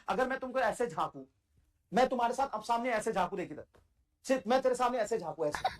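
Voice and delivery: ordinary talk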